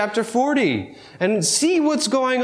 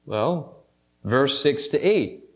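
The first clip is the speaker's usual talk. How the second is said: usual speaking voice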